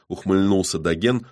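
usual speaking voice

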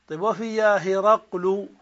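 normal talking voice

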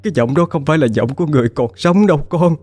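high, distant, trembling insistence; grief-stricken certainty. Acted. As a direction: sob out the truth she can't deny. Grieving mother insists daughter dead. (giọng cao, run rẩy, đau đớn khẳng định)

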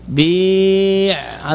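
بيع held very long and slow; drawn-out base form. Shown as a demonstration. Teacher stretching بيع for students, very slow. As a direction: stretch بيع out to let the ya be heard. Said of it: "teacher shows the base form".

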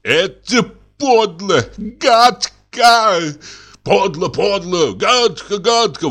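high outraged protest; indignant crowd. Acted. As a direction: spit out the outrage. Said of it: "возмущается публика". (возмущённые возгласы публики)

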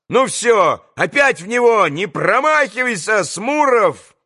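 high-pitched urging shout; rallying cry. A narrator's reading. Boys send Smurov back into battle, rallying cry. Boys urge renewed stone fight.

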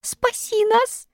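tiny, very high squeak; slow, high, thin monkey voice. A narrator's reading. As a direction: squeak a plea like a little monkey. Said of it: "пискнула обезьянка".

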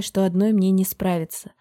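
plain reading voice

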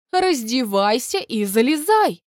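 imperious, drawn-out order; commanding. A narrator's reading. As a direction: order her in, brooking no delay. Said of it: "скомандовала она".

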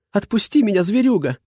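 put-on high, struggling girl's cry; young woman protesting. A narrator's reading. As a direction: yell at him while kicking. Girl slung over his shoulder.

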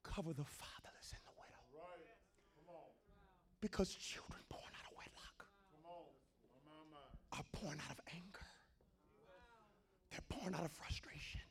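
very soft, whispered pronouncement; hushed preaching. Real, not acted. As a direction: let each phrase fall in a grave whisper. Preacher drops to a hush.

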